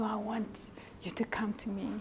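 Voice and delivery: whispered plea. Acted. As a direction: breathe it like a secret longing. God's jealous longing.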